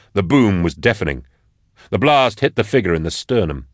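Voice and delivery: ordinary delivery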